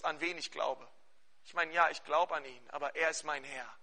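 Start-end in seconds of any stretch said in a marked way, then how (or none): none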